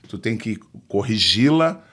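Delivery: normal talking voice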